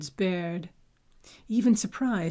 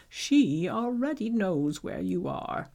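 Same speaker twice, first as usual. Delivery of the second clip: ominous voice. Acted. Abbot's ominous threat.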